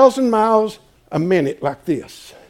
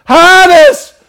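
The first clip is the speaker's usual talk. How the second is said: higher and louder on "hide us"; desperate repeated plea; 13 semitones higher, 12 dB louder than usual. Acted. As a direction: cry "hide us" even harder. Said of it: "And they say".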